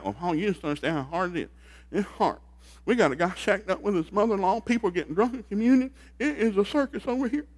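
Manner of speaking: hammed-up plaintive church members griping to Paul; mock whiny church. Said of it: "they've written a letter back".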